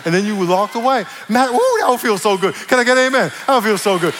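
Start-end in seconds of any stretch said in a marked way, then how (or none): 1.3-4.1 loud, high preacher's exclamation; gleeful relish. Acted. Preacher to congregation imagining sweet payback, gleeful relish. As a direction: revel in it out loud.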